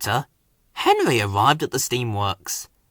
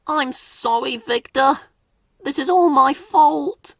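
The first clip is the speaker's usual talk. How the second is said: sad; 11 semitones higher than usual. A narrator's reading. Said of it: "he puffed sadly".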